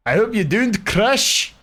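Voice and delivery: high, slow pleading whine. Pretend. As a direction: beg the game not to crash, high and drawn out. Pleading with game to load.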